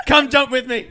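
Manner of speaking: loud, high, laughing invitation; hyped mock invite. Inviting someone into the church mosh pit, loud and excited. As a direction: pump them up like a hyped churchgoer.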